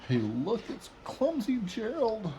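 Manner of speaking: mocking lions.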